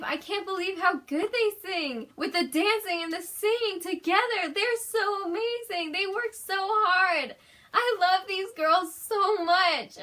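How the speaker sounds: high, loud fangirl gushing; high excited gushing; gushing excitement. Real, not acted. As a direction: rave about the idols breathlessly. Gushing about the idols.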